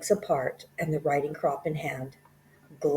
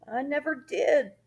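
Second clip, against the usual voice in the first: higher, startled denial; exclaimed; 12 semitones higher than usual. Acted. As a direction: blurt it out in shock. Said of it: "the boy exclaimed".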